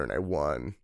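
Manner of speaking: normal talking voice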